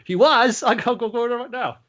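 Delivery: high, loud, giggly voice for the gullible brute; giddy flattered villain. Acted. The big dumb villain, flattered, rushing off giggling high and loud. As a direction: squeal like a flattered dope, laughing.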